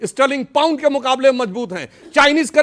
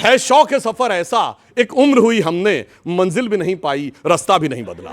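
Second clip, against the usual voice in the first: verse delivery that steps down lower to the last line; poetry recitation; 5 semitones lower than usual. Acted. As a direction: declaim the couplet, drawing out the opening. Recites couplet as mock praise. (शायरी के अंदाज़ में)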